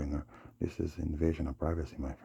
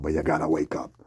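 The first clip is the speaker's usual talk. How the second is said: louder, urgent wake-up call; 8 dB louder than usual. Real, not acted. Urging viewers to wake up, loud. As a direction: shout it to wake them. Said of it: "I know that was kinda loud".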